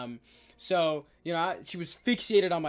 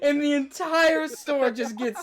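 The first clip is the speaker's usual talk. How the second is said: laughing narration; 10 semitones higher, 9 dB louder, slower than usual. Real, not acted. Laughing while telling.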